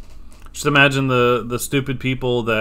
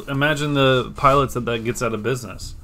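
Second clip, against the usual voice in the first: ordinary delivery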